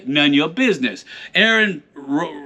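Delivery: usual speaking voice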